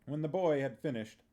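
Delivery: usual speaking voice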